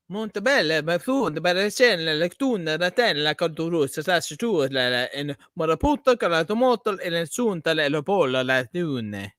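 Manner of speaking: high, loud mock Italian gibberish; hammed-up fake Italian as Da Vinci; fake Italian accent. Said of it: "There's my fake Italian Da Vinci".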